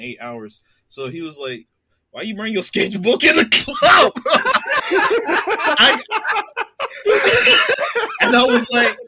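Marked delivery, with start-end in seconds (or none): 2.1-4.1 mock shout. Retold celebrity question, shouted. 4.2-9.0 high, loud cackling laughter; laughter spilling over the words; laughing hard. Real, not acted. Laughing fit at the memory.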